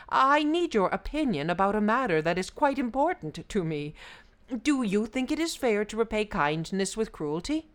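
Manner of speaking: high, loud, brisk appeal; appealing Brahmin. A narrator's reading. Brahmin seeking the buffalo's opinion, urgent appeal. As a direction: ask urgently and earnestly.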